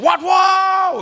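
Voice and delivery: loud high scream; screaming demo. Shown as a demonstration. Preacher demonstrating a loud pained scream. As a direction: yelp out loud in pain. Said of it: "iku jerit-jerit".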